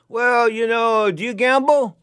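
loud, high, drawn-out boss voice; imitating the boss. Casino owner interviews a new hire, imitated probing boss. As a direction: play the boss, stretching out the question.

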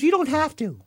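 high, lightly voiced lady; lady's voice. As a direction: answer him lightly, reassuring. Nanette reassures him.

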